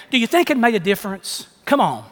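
usual speaking voice

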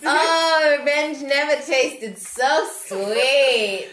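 high, loud, slow gloat with a laugh; gloating triumph. Acted. Player gloating over the rival, slow and loud with a laugh. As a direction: savour the revenge, laughing as it comes out.

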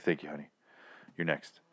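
low, soft aside; imitating a man, hushed and low; retold sweet-talk. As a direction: murmur it low to a honey. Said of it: "and he's like".

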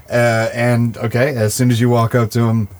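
ordinary talk

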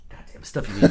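unvoiced annoyed mutter; frustrated mutter while looking for it; muttered grumble. Real, not acted. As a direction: grumble to yourself under your breath.

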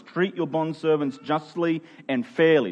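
ordinary talk, nothing marked

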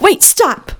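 sudden high-pitched cry to halt; retold excited interruption; imitating urgent stop. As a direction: jump in high, as if stopping someone mid-scroll. Child halting cartoon scrolling, voiced.

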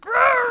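high, loud mock roar; playful monster growl. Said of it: "he was like".

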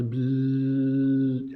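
slow low buzzing bzzz; imitated bee buzz. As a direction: drag out a bee's buzz. Bee flying into the car.

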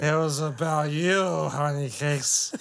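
put-on slow, slurred drunk voice. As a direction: drawl it like a drunk. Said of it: "Bob Saget drunk".

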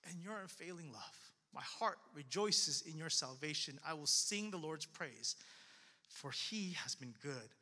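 low, soft psalm reading; quiet trust. Acted. Preacher reads David trusting God's love, softly and low. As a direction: settle into hushed, reverent trust.

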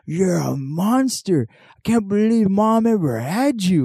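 high-pitched, dragged-out mock outrage; imitated drunken yelling. Drunk man disowning his brother, hammed-up yelling. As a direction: stretch the words like a drunk accuser. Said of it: "one of them yelling at another".

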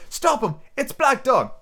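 high-pitched alarmed call; urgent young hero's cry. Acted. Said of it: "I cried".

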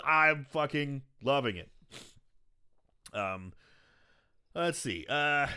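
ordinary talk

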